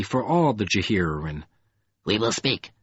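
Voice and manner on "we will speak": creature speaking in a cold, dusky tone. A narrator's reading. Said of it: "the crawler repeated".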